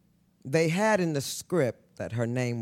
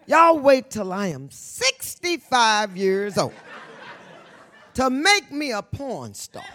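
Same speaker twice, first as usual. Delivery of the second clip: loud, high laughing outcry; laughing exclamation; 6 semitones higher, 5 dB louder, slower than usual. Retold or imitated. Telling the crowd about her sex scenes, loud and laughing. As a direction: crow it out, cracking up. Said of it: "I said".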